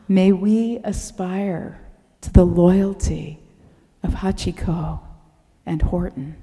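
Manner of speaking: hushed, reverent blessing, slowing at the end; closing prayer. Real, not acted. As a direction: lead the congregation gently into amen. Closes sermon with a blessing.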